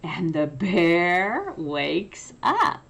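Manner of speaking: plain reading voice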